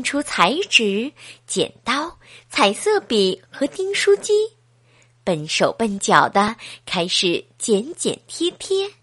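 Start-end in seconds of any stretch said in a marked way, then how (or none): none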